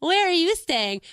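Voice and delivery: put-on high, slow sweet old lady; high mock-friendly old lady greeting. Old lady voiced in story.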